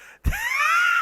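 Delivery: loud, high burst of laughter; helpless laugh. Real, not acted. Podcaster cracking up at his own impression, helpless laugh. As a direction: crack up at yourself.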